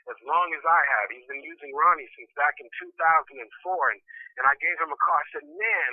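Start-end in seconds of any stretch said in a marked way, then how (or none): 5.4-5.9 high-pitched exasperated "man"; retold upset outburst, pitched up. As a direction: act out venting to a friend. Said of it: "I'm so upset".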